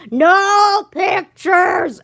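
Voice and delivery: very slow, loud booming shout; shout into a microphone. Retold or imitated. Student imitating her teacher yelling into his microphone, loud and slow. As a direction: boom it like a distorted mic blast. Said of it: "puts the microphone in his mouth and says".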